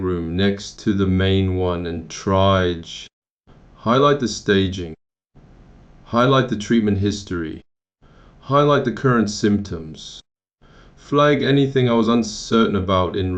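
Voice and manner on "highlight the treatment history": usual speaking voice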